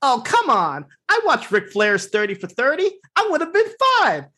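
mock Ace voice. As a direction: whine it, pitch flying up at the end. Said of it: "Ace comes back with the line".

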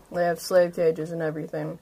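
ordinary talk, nothing marked

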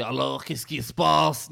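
slow put-on Belgian accent. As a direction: imitate a Belgian shopkeeper's accent, slowly. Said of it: "elle prend l'accent belge". (accent belge imité, lent)

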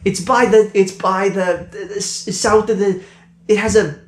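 high, stammering flustered guard. Acted. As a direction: fumble for the directions. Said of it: "he's like".

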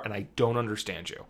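ordinary talk

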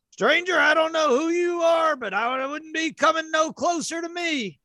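high shouted cowboy warning; gruff gunslinger. Acted. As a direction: bark it out like an outlaw. GM voices gunslinger Jack Crab.

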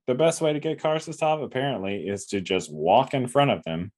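usual speaking voice

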